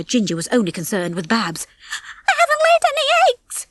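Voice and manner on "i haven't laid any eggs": very high, fretful squeal; panicky dithering. A narrator's reading. Babs dreads roll call without eggs, panicking. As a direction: fret in rising panic. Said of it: "Babs dithered".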